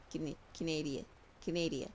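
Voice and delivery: "Canadian" as kuh-NAY-dee-uh, final N barely there; as one word. Shown as a demonstration. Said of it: "say it like one word".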